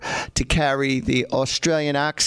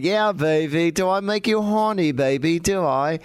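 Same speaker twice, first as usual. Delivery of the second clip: imitating a randy British swinger, higher in the middle; Austin Powers catchphrase; 3 semitones higher than usual. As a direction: tease it out in mock-British. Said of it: "everybody was going".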